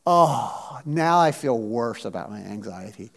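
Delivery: low, sinking deflated voice. Acted. Imagined listener leaving feeling worse.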